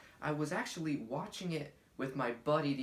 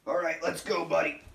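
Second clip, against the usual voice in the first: loud eager cheer; 2 semitones higher, 8 dB louder than usual. Acted. Cheerful wrap-up.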